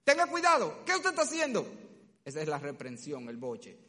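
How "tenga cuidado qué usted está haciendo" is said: high, loud, firm reprimand; loud firm scolding. Shown as a demonstration. Parent stopping a son doing wrong, loud and firm. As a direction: halt him mid-action with force. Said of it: "con voz alta y firme".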